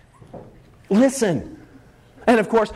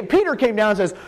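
usual speaking voice

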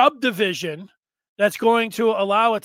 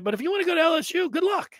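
mock dismissive shout; 7 semitones higher, faster than usual. Telling Bryce he can go to LSU, fast taunting shout. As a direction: toss it off quickly like a taunt.

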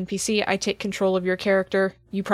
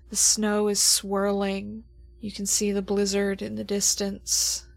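game master scene setting; 4 dB quieter than usual. Acted. GM sets the opening scene.

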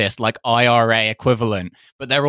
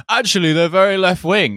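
higher-pitched nationalist party defender; put-on pedant voice; 5 semitones higher than usual. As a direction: correct them in a silly, prissy voice. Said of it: "why do they have that voice?".

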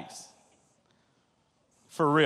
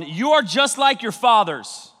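fiery rebuke; 5 semitones higher, 6 dB louder than usual. Acted. Preaching Stephen's rebuke.

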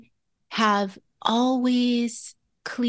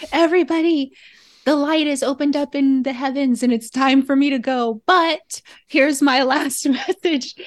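loud, raised, playful proclamation; mock shouted announcement; 5 semitones higher, 5 dB louder than usual. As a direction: call out to everybody, half laughing. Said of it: "sixty seconds to shout out a tip".

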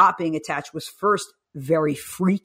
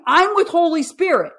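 much higher, louder, light and carefree; son reassuring mom; 9 semitones higher, 6 dB louder, slower than usual. Retold or imitated. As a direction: tell mom he's fine, smiling. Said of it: "So he's saying".

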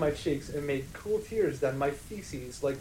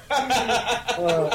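laughing; 7 semitones higher, 5 dB louder than usual. Real, not acted. Still laughing.

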